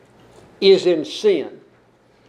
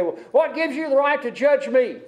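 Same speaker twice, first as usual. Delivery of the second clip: mock indignant, pitched higher; huffy retort; 7 semitones higher than usual. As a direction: imitate someone getting on their high horse. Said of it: "get all huffy and puffy and say".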